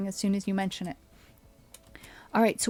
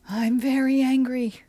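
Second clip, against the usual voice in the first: higher and louder hammed-up anger; bigger mock anger; 4 semitones higher, 7 dB louder than usual. Streamer repeating it with more hammed-up anger. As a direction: push the fake fury up.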